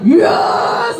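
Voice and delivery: high, loud cheer; cheering shout. Retold or imitated. Passengers cheering the child's remark, loud cheer. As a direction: yell it like a cheering crowd.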